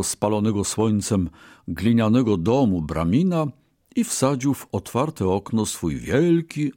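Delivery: plain reading voice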